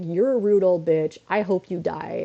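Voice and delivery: usual speaking voice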